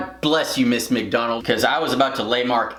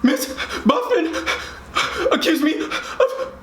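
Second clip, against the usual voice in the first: put-on high, gasping tearful child; mock sobbing; 13 semitones higher than usual. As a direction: imitate crying between broken words. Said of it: "come in sniffling and crying".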